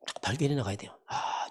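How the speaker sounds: ordinary delivery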